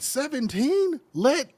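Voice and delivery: high, incredulous rising voice; comic disbelief. Real, not acted. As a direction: pitch shoots up in mock amazement. Disbelief at teen fame, imagines himself.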